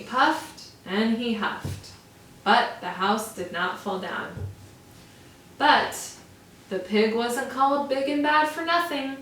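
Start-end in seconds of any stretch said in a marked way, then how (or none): none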